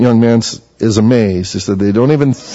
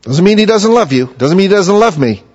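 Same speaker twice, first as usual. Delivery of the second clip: emphatic reassurance; 8 semitones higher, 3 dB louder than usual. Real, not acted. Preacher reassures congregation of love.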